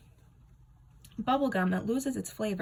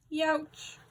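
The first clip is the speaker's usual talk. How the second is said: youch; 8 semitones higher than usual. A narrator's reading. Comic pained exclamation.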